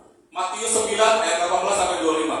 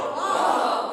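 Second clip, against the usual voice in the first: amazed wow. Shown as a demonstration.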